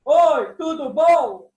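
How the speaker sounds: a mock man's voice saying hello. Shown as a demonstration. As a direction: greet them like a man would. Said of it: "os homens falam como".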